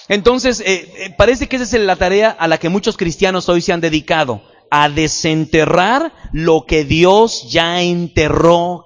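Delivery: loud, high preaching push; fervent pulpit delivery. Acted. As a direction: drive it home to the congregation. Preaching at full force.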